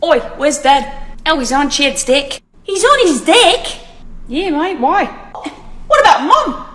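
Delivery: a light mock Australian accent. Mocking how Australians talk, in a lightly put-on Australian accent. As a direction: ham up a bloke's Aussie banter. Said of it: "how I believe Australian conversations go".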